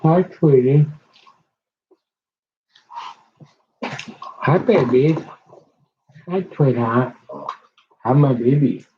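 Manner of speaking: talking to a dog. Real, not acted. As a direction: coo hello to your dogs. Greeting her dogs affectionately.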